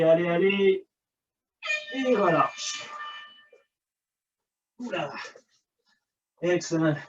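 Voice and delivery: ordinary delivery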